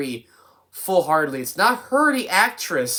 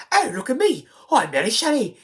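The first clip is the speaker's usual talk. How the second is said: high-pitched hammed-up character impression; Mary Shelley; 8 semitones higher than usual. Reviewer mimicking the actress going into the author persona, hammed-up impression. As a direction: put on a much higher mock character voice. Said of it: "talking like Mary Shelley".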